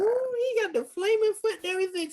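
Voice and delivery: very high, hyped-up voice; high-pitched awed outburst; excited squeal. Retold or imitated. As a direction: squeal it like an amazed fan. Excited reaction to the flaming kick.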